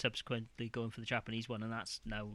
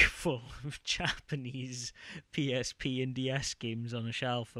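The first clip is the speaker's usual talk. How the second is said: ordinary talk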